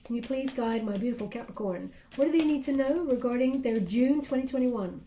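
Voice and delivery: ordinary talk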